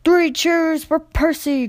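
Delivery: rally the crowd; calling out. A narrator's reading. Vicar leading children in cheers, calling out. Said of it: "called the vicar".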